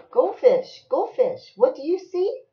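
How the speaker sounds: high, loud singsong refrain; lilting storybook call; reading to a child. Acted. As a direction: bounce the name, lift the question. Reading picture book aloud to child.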